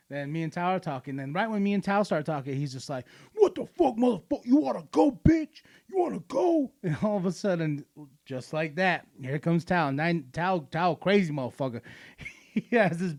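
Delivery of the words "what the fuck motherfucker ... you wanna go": put-on high-pitched drunk picking a fight; mock drunken taunting; belligerent drunk. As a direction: imitate a drunk's squealed challenge. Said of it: "this drunk native dude comes up".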